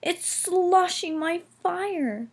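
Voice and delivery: high, slow worried wail; high slow dismayed complaint; distressed. A narrator's reading. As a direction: drag it out in dismay. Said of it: "he hissed".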